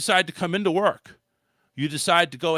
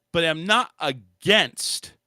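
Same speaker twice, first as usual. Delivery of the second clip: ordinary talk, nothing marked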